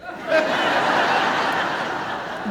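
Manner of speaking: high breathless laugh; real laughter at the joke; hearty laugh. Real, not acted. Speaker laughing at own joke.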